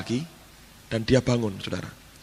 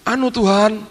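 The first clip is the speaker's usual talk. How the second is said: high-pitched, hesitant plea to the Lord; imitating apologetic servant; 7 semitones higher than usual. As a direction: beg pardon as the maid, pitched well up. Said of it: "ini pembantu ngomong gini".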